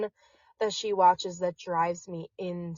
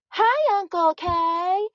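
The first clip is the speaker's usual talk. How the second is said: put-on high, slow sing-song kid voice; lilting high kids' video talk; imitating kids' show voices; 12 semitones higher, 4 dB louder, slower than usual. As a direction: mimic the chirpy scripted video kids. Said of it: "they all talk like this".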